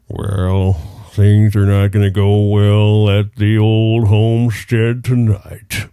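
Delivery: slow, loud cartoon anteater voice; a downcast cartoon anteater breaking bad news; exaggerated cartoon intonation. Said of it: "I want you to give me a cartoon character voice".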